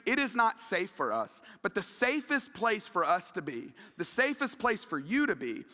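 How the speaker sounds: usual speaking voice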